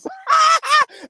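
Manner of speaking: squealing high laugh; high shriek of laughter. Real, not acted. Cracking up at the herpes story, high shrieking laugh. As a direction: burst out in a high cackle.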